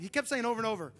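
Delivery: ordinary talk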